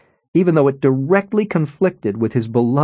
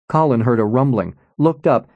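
usual speaking voice

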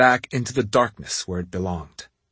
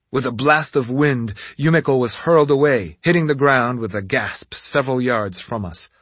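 usual speaking voice

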